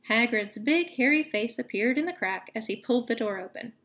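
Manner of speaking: ordinary delivery, nothing marked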